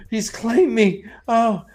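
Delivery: high, slow mock begging; pleading Lanfear. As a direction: ham up a desperate plea, up high. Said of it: "she's like".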